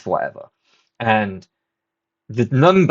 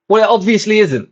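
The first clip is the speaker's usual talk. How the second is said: high, louder, fed-up snap back at the ticket guy; exasperated retort; 9 semitones higher, 4 dB louder than usual. Retold or imitated. To the ticket guy about subtitles, exasperated high retort. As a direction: raise the voice in disbelief.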